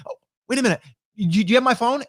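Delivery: high, hammed-up sudden panic; comic retelling of someone panicking over a phone; mock panic. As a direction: play a person suddenly missing a phone. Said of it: "they're like".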